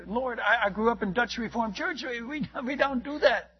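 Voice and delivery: acted-out churchgoer's protest; protesting excuse. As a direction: stammer an excuse to God, mock earnest. Acts out second excuse to God.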